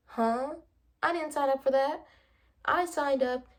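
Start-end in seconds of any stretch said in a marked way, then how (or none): none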